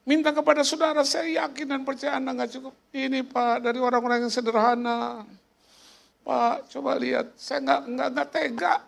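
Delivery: high pleading appeal; memohon sumbangan. Retold or imitated. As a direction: imitate someone begging the congregation, voice held high. Meniru orang yang minta sumbangan. (meniru orang memohon dengan nada tinggi)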